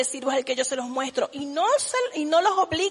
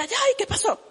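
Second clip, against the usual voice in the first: startled cry; 7 semitones higher than usual. Retold or imitated. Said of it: "caiga y diga".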